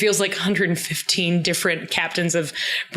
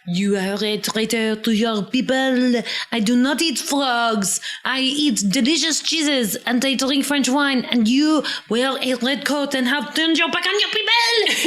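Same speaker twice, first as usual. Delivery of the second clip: put-on French accent; 5 semitones higher than usual. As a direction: play a haughty little French boy. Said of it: "in his little tiny French actor accent".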